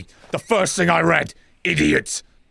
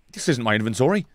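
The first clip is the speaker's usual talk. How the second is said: normal talking voice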